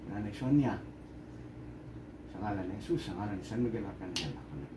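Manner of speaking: soft, low healer's invocation; hushed, low ritual prayer; murmured prayer. Real, not acted. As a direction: pray under your breath, low and even.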